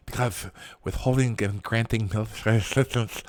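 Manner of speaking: put-on politician voice, soft and monotone; soft, droning caricature voice; Nancy Pelosi. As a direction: do a mocking impression of a politician, low and quiet. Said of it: "my best Nancy Pelosi voice".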